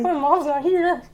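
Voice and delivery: put-on slow squeaky kid voice with a laugh; high child voice, laughing. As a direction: whine it like a lost little kid. Child voice retelling bathroom scene.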